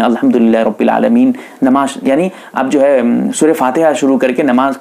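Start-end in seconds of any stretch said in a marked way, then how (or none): 0.0-1.3 quick, much lower intoned snippet imitating the imam; imam's recitation. Teacher mimicking the imam beginning Fatiha, low and quick. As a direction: rattle off the opening like the imam, low. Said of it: "इमाम साहब शुरू कर देते हैं".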